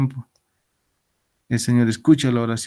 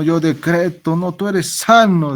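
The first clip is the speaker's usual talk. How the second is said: raised, mocking faith-healer tone; higher, slower mock proclamation; mock decree; 5 semitones higher, 4 dB louder, slower than usual. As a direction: send up a preacher who decrees healing.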